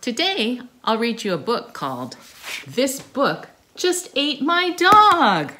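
normal talking voice

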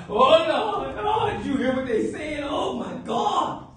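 mock whining to God. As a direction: whine at God like a self-pitying churchgoer. Imitating believers whining to God.

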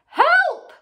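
high, loud panicked scream. A narrator's reading. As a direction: scream for help. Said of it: "screamed Missus Twit".

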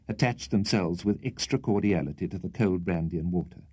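ordinary delivery, nothing marked

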